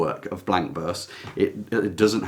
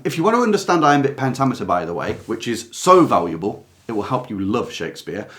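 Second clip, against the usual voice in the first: ordinary talk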